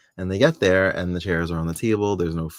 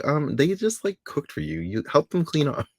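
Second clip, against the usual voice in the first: higher-pitched mock indignation, dipping midway; mildly annoyed retort; 7 semitones higher than usual. Host replaying their retort to men leaving cleanup to women, mildly annoyed. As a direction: imitate a miffed objection, voice lifting at the end. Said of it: "I got a little annoyed".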